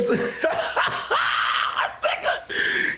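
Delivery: high wheezing laugh; breathless high cackle. Real, not acted. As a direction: let out a squealing laugh. Laughing at his own joke.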